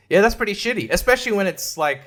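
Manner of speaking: usual speaking voice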